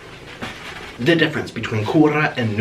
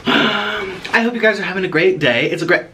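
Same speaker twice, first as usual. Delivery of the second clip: ordinary delivery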